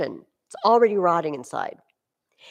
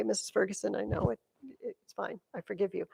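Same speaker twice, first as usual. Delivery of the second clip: soft, hushed imitation of a forgiving friend; quiet acted absolution; gentle forgiveness; 11 dB quieter, faster than usual. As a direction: drop right down, lightly reassuring. Voicing Lauren gently forgiving her.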